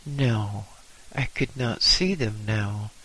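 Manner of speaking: plain reading voice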